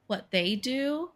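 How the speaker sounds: normal talking voice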